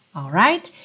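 normal talking voice

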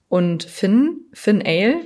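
usual speaking voice

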